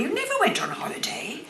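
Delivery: hushed whisper; whispered aside. Real, not acted. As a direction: drop to a confiding whisper. Aside about being poor actors.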